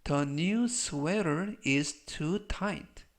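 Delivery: very slow, drawn-out words; slow model reading. Shown as a demonstration. Teacher models the sweater sentence for learners, slow model reading. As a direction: stretch each word out for learners to copy.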